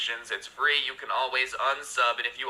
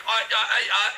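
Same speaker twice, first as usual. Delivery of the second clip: high, louder stuttered "uh"s; mock flustered stammering; 10 semitones higher, 5 dB louder than usual. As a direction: sputter like someone lost for words. Girlfriend stammers, lost for words.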